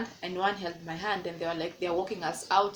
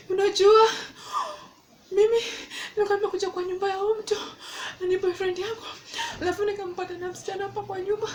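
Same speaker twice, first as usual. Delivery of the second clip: crying plea; 11 semitones higher, faster than usual. Retold or imitated. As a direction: sob the story out to the neighbour. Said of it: "And I cried".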